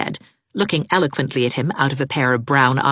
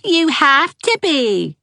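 put-on high, slow teasing child echo; high sing-song mimicry; mocking echo; 6 dB louder, much slower than usual. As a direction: parrot her back. Said Geraldine.

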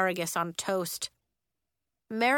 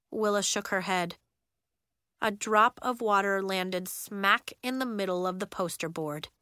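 ordinary delivery

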